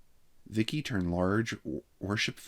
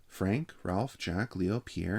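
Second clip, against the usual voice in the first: ordinary delivery, nothing marked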